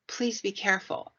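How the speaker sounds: usual speaking voice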